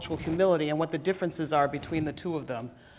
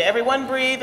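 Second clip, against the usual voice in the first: ordinary delivery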